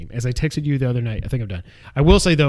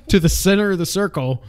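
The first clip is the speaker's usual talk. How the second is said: laughing; 6 semitones higher than usual. Real, not acted. Laughs mid-sentence.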